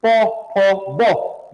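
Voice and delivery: "पो, फो, बो" popped out with a burst of air; "पो, फो, बो" like a firecracker, lots of air; bursting consonants. Shown as a demonstration. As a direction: release "पो, फो, बो" explosively from closed lips. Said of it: "ये स्फुटन जैसा होता है".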